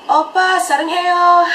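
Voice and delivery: high, loud adoring call; fangirl shout. Retold or imitated. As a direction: cry it out to an idol onstage. Reenacting fans calling to an idol.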